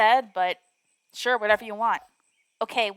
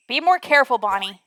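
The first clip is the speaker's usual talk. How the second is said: ordinary talk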